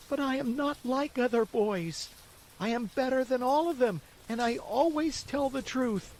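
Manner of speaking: high eager puppet voice, well up in pitch; high, earnest child pleading with his father; pleading boy. Acted. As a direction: beg Father to believe him.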